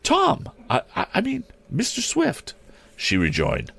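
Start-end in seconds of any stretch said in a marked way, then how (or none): 0.0-2.5 put-on high girlish voice, stumbling; flustered young lady. A narrator's reading. Girl greets Tom, then corrects herself, flustered.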